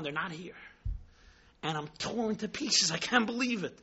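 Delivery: usual speaking voice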